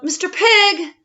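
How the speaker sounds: calling loudly to the pig. Acted. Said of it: "I called".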